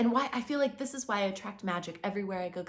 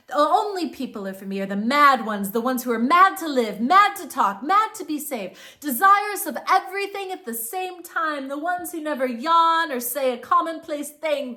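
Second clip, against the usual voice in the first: loud, building recitation; a fired-up reading of a famous line; passionate quote; 3 semitones higher, 8 dB louder than usual. Acted. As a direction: declaim it like a manifesto. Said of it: "Dirac says".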